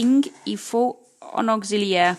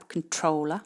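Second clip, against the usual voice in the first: controller, weight on the -trol- syllable; stress on second syllable; 3 semitones lower, 4 dB quieter than usual. Shown as a demonstration. Teacher repeating controller, second syllable stressed. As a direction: hit the middle of controller. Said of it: "c'est la deuxième syllabe".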